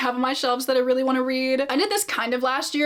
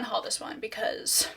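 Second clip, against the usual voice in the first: whispering; 8 dB quieter than usual. Real, not acted. Confessing unhaul decision.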